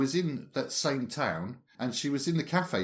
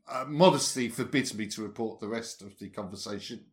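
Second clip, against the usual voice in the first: ordinary talk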